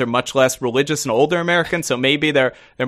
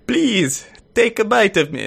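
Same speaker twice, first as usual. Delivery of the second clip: higher-pitched, hammed-up Italian accent; botched Italian accent; 6 semitones higher than usual. Talking pig begging to be eaten, in a botched Italian accent. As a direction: plead playfully in a faked Italian accent. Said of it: "my Italian accent".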